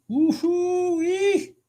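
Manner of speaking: very high drawn-out whoop; real trader's victory whoop; whooping cheer. Real, not acted. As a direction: let out a long delighted whoop. Cheers at big candle.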